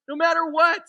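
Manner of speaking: high, loud, insistent assurance; emphatic repeat. Real, not acted. Preacher assuring nothing can take it away, emphatic and loud.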